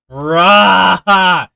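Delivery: sudden loud, high exasperated bruh. Real, not acted. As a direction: blurt it out in frustration. Level going wrong.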